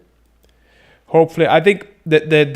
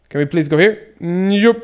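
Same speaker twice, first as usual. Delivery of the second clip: ordinary talk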